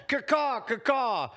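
high crow caw, shouted; shrill screeching bird woman. Acted.

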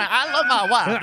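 high, slow, laughing senator voice; definitely straight senator voice. Acted. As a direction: overdo the proud husband politician. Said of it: "definitely straight senator voice".

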